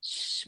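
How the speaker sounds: imitando um chiado longo para calar; faça "shh" como quem manda ficar quieta; "shh" pedindo silêncio. Retold or imitated. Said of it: "mandou ficar quieta". (chiado de quem pede silêncio)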